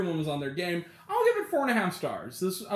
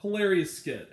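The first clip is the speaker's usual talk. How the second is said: normal talking voice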